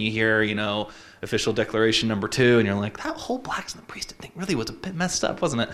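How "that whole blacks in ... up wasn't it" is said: a hushed, secret whisper of doubt; mock whisper. Whispering a doubt about church history to himself. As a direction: whisper it like a forbidden thought. Voicing a private doubt in class.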